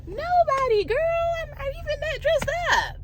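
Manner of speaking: mock high squeaky protest to her daughter; high teasing answer to her daughter; retold reply. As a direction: pitch it way up, playful and defensive. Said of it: "And I'm like".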